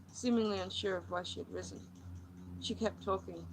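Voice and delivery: plain reading voice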